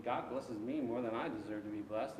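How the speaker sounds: normal talking voice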